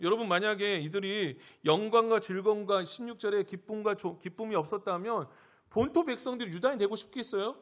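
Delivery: ordinary talk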